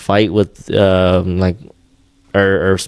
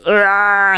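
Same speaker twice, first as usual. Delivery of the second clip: high-pitched imitated child's wail; mock crying wail; 12 semitones higher than usual. Father imitating his crying kids, high mock wail. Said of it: "they're crying and you're getting frustrated too".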